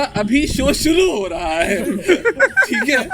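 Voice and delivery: slow words through real laughter; giggling while trying to start the show; laughing. Real, not acted. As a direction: laugh your way through the line. Host laughing at co-hosts' teasing.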